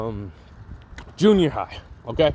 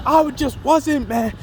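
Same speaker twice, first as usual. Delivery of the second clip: anguished scream; 10 semitones higher, slower than usual. Retold or imitated. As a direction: scream out the defeat. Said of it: "he's like".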